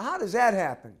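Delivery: usual speaking voice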